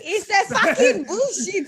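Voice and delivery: hammed-up South African colonial accent through laughter; mock South African accent. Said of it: "you think I sounded like Cecil John Rhodes".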